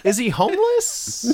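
high slow surprised question. Real, not acted. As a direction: ask it in disbelief.

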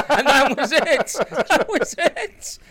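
high, loud laughing repeat; laughing through the words. Real, not acted. Laughing at the pilot story.

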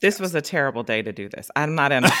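ordinary talk

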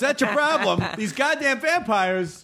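high-pitched mock rant at bar owners; ranting bar rescuer. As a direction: play an exasperated rescue-show host, voice pushed up. Acting bar rescuer among vampires.

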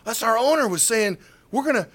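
high, loud imitation of thrilled hosts; excited. Radio hosts repeating the owner's promise, excited. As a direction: gush over the big news. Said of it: "They were so excited".

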